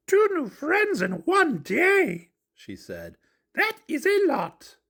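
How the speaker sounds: high, slow motherly monster voice; high puppet mom, impressed. Acted. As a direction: marvel at your kid's news.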